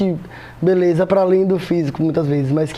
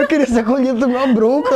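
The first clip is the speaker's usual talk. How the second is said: mock whiny reply; 6 semitones higher than usual. Retelling reply to mother.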